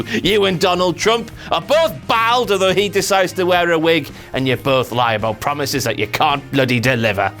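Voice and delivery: ordinary talk, nothing marked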